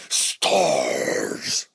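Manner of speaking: very low, drawn-out monster growl; guttural creature roar at speaking volume; Nemesis. Acted. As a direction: growl it out long and deep like a lumbering monster. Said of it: "you hear him stomping and going".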